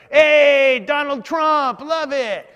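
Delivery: loud, high, drawn-out street holler; imitating a biker's whooping shout. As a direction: yell it like a rowdy biker hailing a celebrity. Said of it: "And he's like".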